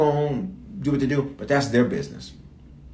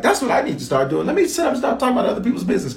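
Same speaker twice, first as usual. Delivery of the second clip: mock eagerness; 11 semitones higher, much faster than usual. Mock eager plan to gossip.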